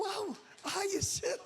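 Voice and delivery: high, slow, laughing wow. Retold or imitated. Pastor retelling his amused reply, laughing. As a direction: laugh through the words with delight.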